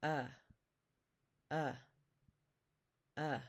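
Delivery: "uh" said as the vowel in English up; vowel sound demo. Shown as a demonstration. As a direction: teach "uh" like the start of up, each one separate. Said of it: "the vowel in up".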